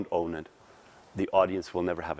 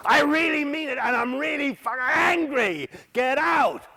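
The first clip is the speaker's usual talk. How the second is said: mocking a student yelling much higher and louder; imitated angry shout; 6 dB louder than usual. Coach mimicking an inexperienced actor shouting in anger. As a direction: ham up a young actor forcing fury. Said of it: "Oh God, they're really hurting their vocal cords".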